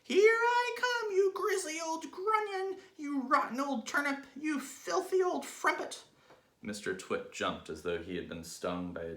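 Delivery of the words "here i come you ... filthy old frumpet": shouting at the top of her voice. Acted. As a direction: bellow the insults down from the sky. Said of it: "called out at the top of her voice".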